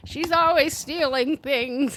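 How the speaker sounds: put-on high, slow old-lady accusation; slow high grandma grumbling about theft. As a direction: accuse her like a grumpy grandma. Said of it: "she's like".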